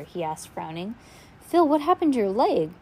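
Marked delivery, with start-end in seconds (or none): 1.4-2.7 high, loud worried question; startled concern; alarmed question. A narrator's reading. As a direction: ask in alarm about the leg. Violet alarmed at injured leg.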